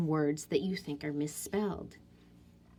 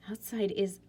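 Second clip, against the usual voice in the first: whisper. Real, not acted. Whispering while checking.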